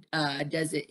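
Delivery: usual speaking voice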